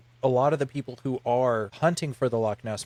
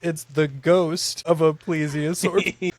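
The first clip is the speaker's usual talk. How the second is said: spooky ghost voice; 3 semitones higher, 3 dB louder, slower than usual. Pretend. Repeating theory in spooky voice.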